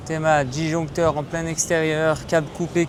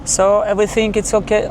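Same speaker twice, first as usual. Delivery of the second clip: English with a higher, louder delivery; raised-pitch switch into accented English; 5 semitones higher, 6 dB louder than usual. Real, not acted. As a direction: slip loudly into an English-speaking accent. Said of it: "j'ai gagné mon accent anglais".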